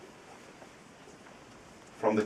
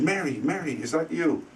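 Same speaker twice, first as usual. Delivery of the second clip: raised, questioning call to Mary; uncle calling, higher; 8 semitones higher than usual. Retold or imitated. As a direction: call out to her higher, unsure.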